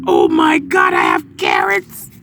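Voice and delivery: low, loud burst of joy; excited exclaim. Real, not acted. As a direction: shout out the happy news. Finds another carrot, exclaims.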